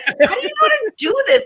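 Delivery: high-pitched, laughing disbelief; retold amazement. Amazed sister questioning her computer-whiz brother, incredulous. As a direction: ask it high, astonished and amused.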